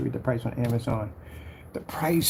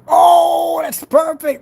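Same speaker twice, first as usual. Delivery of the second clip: much higher and louder cry of joy; delighted shout; 14 semitones higher, 10 dB louder, much slower than usual. Real, not acted. Finding the slogan for his label, shouting with delight. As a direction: burst out thrilled at the idea.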